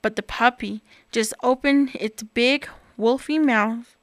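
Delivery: usual speaking voice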